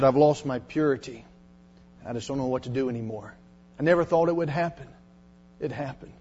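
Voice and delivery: quick, ashamed low murmur; hushed confession. Retold or imitated. Girl confesses, crying at invitation.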